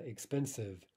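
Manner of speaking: ordinary talk, nothing marked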